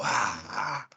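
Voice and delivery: normal talking voice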